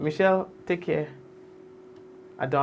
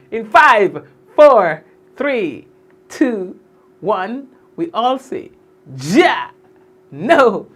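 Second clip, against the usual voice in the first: high, loud count building to a shout; shouted countdown; 4 semitones higher, 9 dB louder, much slower than usual. Real, not acted. Host leads viewers in the sign-off, shouting. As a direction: call out each beat, punching the final words.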